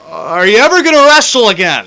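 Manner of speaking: loud, high-pitched heckler's shout; yelled out. Retold or imitated. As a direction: yell the question across a crowded comedy show. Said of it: "yelled out".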